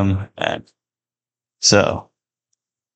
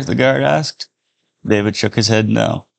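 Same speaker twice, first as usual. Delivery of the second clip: plain reading voice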